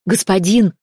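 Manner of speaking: loud call from afar. Acted. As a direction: call out to him urgently. Servant calls out running.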